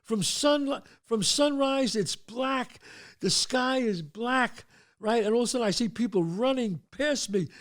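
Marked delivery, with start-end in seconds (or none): none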